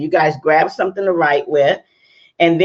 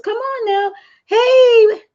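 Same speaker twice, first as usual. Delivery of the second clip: high-pitched squeal of a greeting, loud on hey; a host squealing at her Periscope viewers as they arrive; excited call-out; 4 dB louder, slower than usual. Real, not acted. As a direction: shriek hello to the viewers.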